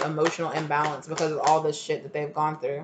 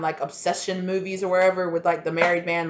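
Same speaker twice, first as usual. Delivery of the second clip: ordinary talk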